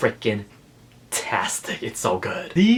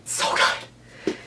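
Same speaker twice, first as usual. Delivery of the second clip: choked whisper; 4 dB quieter than usual. Real, not acted. Emotional about the book.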